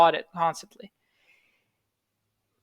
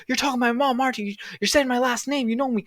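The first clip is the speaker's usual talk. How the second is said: higher, accusing demand through the door; retold suspicious roommate, pitched up; imitating angry roommate; 7 semitones higher than usual. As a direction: play the paranoid roommate pounding on the door. Roommate accuses him again.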